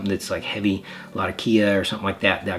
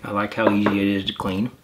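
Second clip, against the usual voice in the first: speaking with clenched teeth; talking around pipe stem; 3 dB louder than usual. Real, not acted. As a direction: pipe in mouth. Seen 2: Pipe stem held in teeth throughout; reference frames show free mouth.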